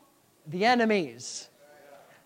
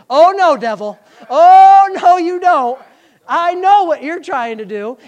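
loud, raised shout of refusal; defiant rebuke; 8 semitones higher, 15 dB louder, slower than usual. Acted. Believer telling the devil off, loud defiant rebuke. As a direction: drive the enemy off with a booming "oh no".

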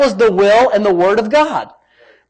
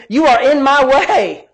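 stern rebuke; 5 semitones higher, 4 dB louder, slower than usual. Acted. Acts out Jesus rebuking Peter.

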